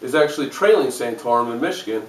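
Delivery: ordinary delivery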